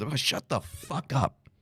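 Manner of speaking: drawn-out fed-up retort; contemptuous. Real, not acted. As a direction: shut them down with scorn. Dismissing critics with contempt.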